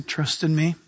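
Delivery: low, soft and fading; hushed, low reassurance; still small voice. Retold or imitated. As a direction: speak it like a gentle whisper from God. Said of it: "the Lord is saying".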